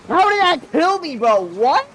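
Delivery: high, loud outraged yell; raging gamer. Real, not acted. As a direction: shout in disbelief at the death. Gamer dies unexpectedly.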